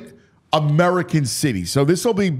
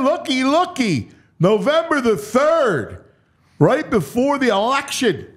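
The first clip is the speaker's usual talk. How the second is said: excited; 10 semitones higher than usual. Real, not acted. Host spotting a marquee game.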